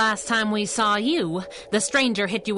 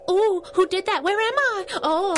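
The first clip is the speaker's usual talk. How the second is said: squeaky startled confusion, much higher; dazed high yelp; 12 semitones higher than usual. Acted. Squirrel dazed after the scuffle, high startled squeak. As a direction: sound knocked silly and lost.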